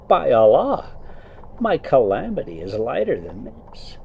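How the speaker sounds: put-on reading the old tale aloud, a touch storyteller; story narration. A narrator's reading. Trucker reading to his viewers while driving, story narration. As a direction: read it like a bedtime book. Reads Arabian Nights aloud.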